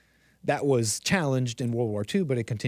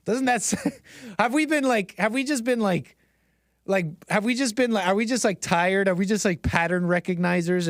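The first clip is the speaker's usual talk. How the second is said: incredulous; 7 semitones higher than usual. Real, not acted. Incredulous rhetorical questions to audience.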